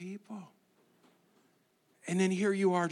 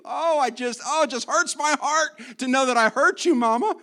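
higher and louder hammed-up heartbroken kid; gushing child; 8 semitones higher, 7 dB louder than usual. Child gushes sorry to mama, hammed-up high and loud. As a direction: overdo a child's tearful remorse to mama. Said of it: "You want your kids just stand in a row".

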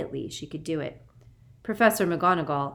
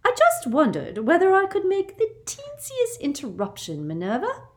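put-on simpering high, girlish woman; sickly sweet and very high; sugary high voice; 6 dB louder than usual. A narrator's reading. Umbridge's voice in the story.

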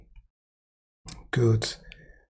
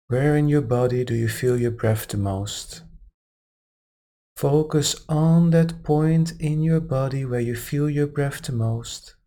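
calm, unhurried meditation guide; guided meditation; 3 semitones higher than usual. Acted. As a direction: ease them gently into relaxation. Meditation guidance resumes after aside.

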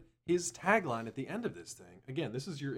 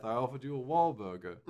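mock dramatic intro; 3 semitones higher, 9 dB louder than usual. Parody of the intro, loud.

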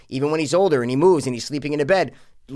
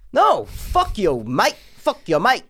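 mock British accent; 6 semitones higher, slower than usual. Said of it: "definitely British".

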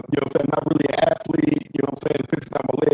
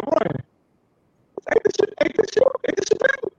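disbelieving cousin; 14 semitones higher than usual. Retold or imitated. Quotes cousin's disbelief, high voice.

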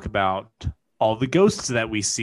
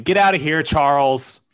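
shouting; 4 semitones higher, 7 dB louder than usual. Pretend. Shooing the ghost Charles.

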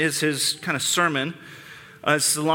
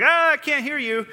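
much higher mock childish shout, ears covered; childish yell; 11 semitones higher than usual. The enraged crowd drowning out Stephen, mock childish yell. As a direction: squeal it like a kid blocking out a scolding. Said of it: "They yell like children".

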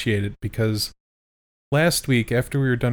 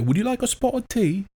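higher mock British accent; 11 semitones higher than usual. Co-host imitating the host's British side. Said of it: "That's how it would go".

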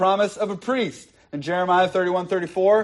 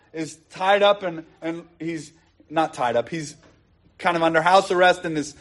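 ordinary talk, nothing marked

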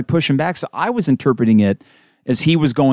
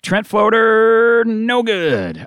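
excited call; 9 semitones higher, much slower than usual. Real, not acted. Live call of a missed shot.

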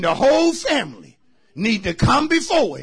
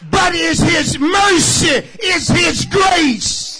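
loud, drawn-out preaching cadence; full-force preaching; 6 semitones higher, 6 dB louder, slower than usual. Acted. Preacher pressing the congregation to give thanks, loud and drawn out.

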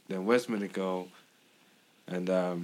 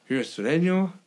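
pitched-up suspicious question on "sureño"; imitating the dude; 7 semitones higher than usual. Kid grilling him about his brown belt, mock suspicious. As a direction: ask it high like a suspicious kid.

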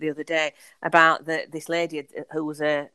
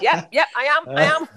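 laughing yes; 8 semitones higher, 6 dB louder than usual. Retold or imitated. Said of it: "And I said".

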